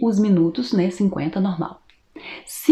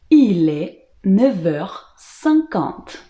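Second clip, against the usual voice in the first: slow, in separate chunks with pauses; careful word-group dictation for learners; slow French model; much slower than usual. Shown as a demonstration. Teacher modelling the answer about the time, slow and segmented. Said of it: "E a resposta sempre vai ser".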